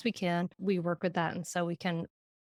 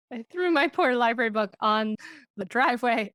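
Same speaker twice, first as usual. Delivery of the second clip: ordinary delivery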